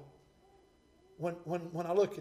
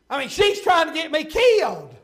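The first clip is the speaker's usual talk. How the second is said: loud pulpit exclamation; full-force preaching; 8 semitones higher, 11 dB louder than usual. Acted. Preacher warning young people about their company, loud preaching. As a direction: press it home loudly to the congregation.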